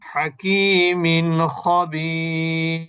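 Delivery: very slow chanted হাকিমিন খবির; clear nun. Shown as a demonstration. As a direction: stretch the long vowels, keep the n of হাকিমিন unhummed. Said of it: "নুনের উচ্চারণ স্পষ্ট". (হাকিমিন খবির ধীর তিলাওয়াতে, নুন স্পষ্ট)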